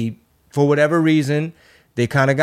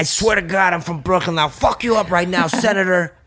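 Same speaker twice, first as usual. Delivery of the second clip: high tough Brooklyn threat; Brooklyn accent; 5 semitones higher than usual. Retold or imitated. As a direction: play a Brooklyn tough guy. Brooklyn tough-guy continuation.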